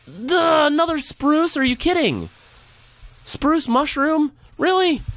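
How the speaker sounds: high, exasperated squeak of disbelief. Real, not acted. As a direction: squeal at yet another spruce. Frustrated at wrong sapling.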